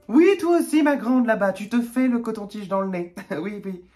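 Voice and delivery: high, loud sing-song order, easing off; hammed-up calling out. Store manager singling out a teammate, high teasing call.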